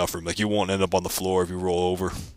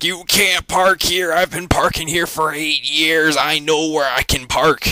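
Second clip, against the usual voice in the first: put-on shrill angry old lady; 9 semitones higher, 5 dB louder, slower than usual. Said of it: "she's like".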